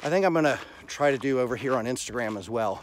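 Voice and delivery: ordinary delivery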